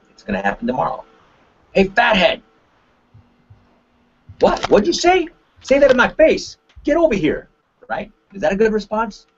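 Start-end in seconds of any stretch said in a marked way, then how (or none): 1.7-2.4 high, mocking name-calling; acted schoolyard taunt. Acting out a kid's taunt. 4.4-7.5 high-pitched, indignant challenge; acted fight-picking comeback; angry confrontation.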